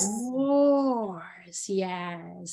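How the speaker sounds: "Of course" stretched slowly, dragging the vowels; milk it as long as you can; drawn out. Shown as a demonstration. Teacher stretching of course to ten seconds. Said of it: "make it last ten seconds".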